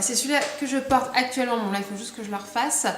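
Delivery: usual speaking voice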